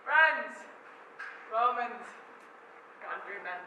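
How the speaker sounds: rally the crowd to listen; Antony addressing the crowd; oration. Acted.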